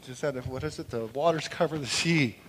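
ordinary delivery

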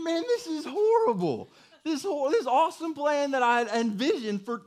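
high-pitched exasperated lament; hammed-up despair at a ruined plan; comic dismay. Retells despair at being laughed at.

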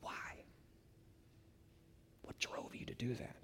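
hushed whisper, barely voiced; whispered question. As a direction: lean in and whisper the question to her. Asking the woman, hushed.

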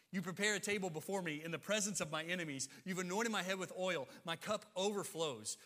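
Psalm 23 proclaimed from the pulpit; proclaiming scripture. Acted. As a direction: declare the psalm with swelling conviction. Reciting Psalm twenty-three.

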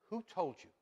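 ordinary talk, nothing marked

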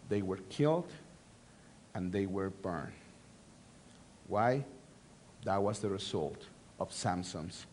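preacher musing quietly on the cost of anger; hushed somber preaching. Acted. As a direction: let the weight of the deaths sink in quietly. Somber reflection on the deaths.

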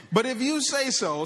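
grudging agreement. Acted. Simon giving in to Jesus, grudging and loud. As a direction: give in with a reluctant edge. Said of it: "perhaps the tone here".

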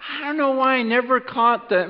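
ordinary delivery

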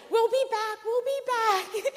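high, loud sing-song call. Retold or imitated. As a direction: shout a cheery goodbye out the car window. Friend calling out the window.

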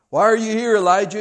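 loud, high, jolting question; shaking him up. Acted. God shaking Elijah up with a loud call. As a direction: call it out to jolt him awake. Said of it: "God is shaking Elijah up".

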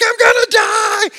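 very high, loud mock-terrified screams; hammed-up panicked shriek; panicked screaming. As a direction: reenact a child screaming for help. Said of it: "I just started panicking".

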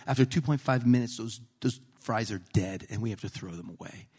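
hushed imitation of a deadly serious manager; really serious. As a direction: pronounce it gravely, like an overearnest boss. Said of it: "This guy is really serious".

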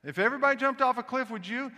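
hammed-up Southern mom, voice shooting up in disbelief; exasperated mother. Mother scolding her smart-mouthed son, high, loud and fast. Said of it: "Smart little mouth on the young kid".

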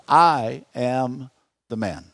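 weighty, spaced-out admission of guilt; deliberate admission. Acted. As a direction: own up to it word by word. Models owning up in the mirror.

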